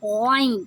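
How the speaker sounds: "عین" said as ghain, rasped at top of throat; gargled Ghain. Shown as a demonstration. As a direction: start "عین" with a voiced gargle. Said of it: "سانس کی نالی کے شروع سے". ("عین" غرارے والی غین کی آواز سے)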